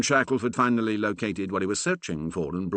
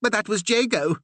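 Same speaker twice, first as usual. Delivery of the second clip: high, insistent protest; 14 semitones higher, 4 dB louder than usual. A narrator's reading. Curate protesting to the Reverend, high and insistent. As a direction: push back against him. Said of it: "protested Percy".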